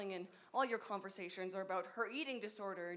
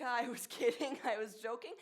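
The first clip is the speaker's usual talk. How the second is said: mock laughing excuse; 6 dB louder than usual. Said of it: "Do you say".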